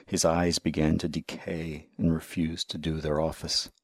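plain reading voice

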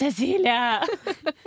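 mother outside the window calling her daughter. Retold or imitated. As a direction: call her name slowly through the glass.

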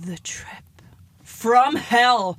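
hushed start, then loud burst; horror trailer announcer. Pretend. Co-host announcing the segment, dramatic hushed-to-loud horror style. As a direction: whisper the title, build to a roar.

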